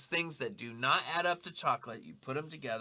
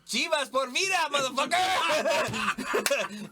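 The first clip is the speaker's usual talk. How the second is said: imitated war cry, much higher and louder; retold proud yell; 11 semitones higher, 6 dB louder, slower than usual. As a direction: holler it like a team chant, last word stretched. Retold proud yell at warehouse guys.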